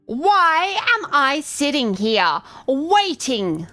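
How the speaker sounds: high, slow, sneering queen voice; shrill disdainful goblin queen. Acted. Goblin chieftain scorns the cleric, high shrill disdain. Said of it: "Her words are tainted in disdain".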